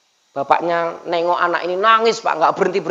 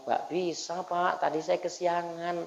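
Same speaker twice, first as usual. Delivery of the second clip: soft, slow, sheepish admission; embarrassed; 14 dB quieter, slower than usual. Acted. Pupil admitting to the religion teacher he overslept, embarrassed. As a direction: answer quietly, shy and slowed down. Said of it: "Anaknya malu bilang".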